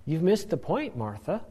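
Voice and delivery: soft, mild reproof; Jesus, gently chiding. Acted. Jesus telling Martha she missed the point, gently chiding. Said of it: "He's gently chiding her".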